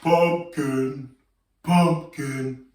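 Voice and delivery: low, slow pumpkin voice. Shown as a demonstration. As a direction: drop the voice for a big pumpkin.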